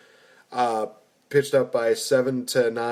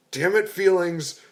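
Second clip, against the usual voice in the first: overwhelmed by cuteness; 6 semitones higher than usual. Retold or imitated. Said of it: "I literally just broke down".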